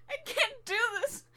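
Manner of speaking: crying, voice breaking; tearful. Acted. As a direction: say it through tears. Crying over failures.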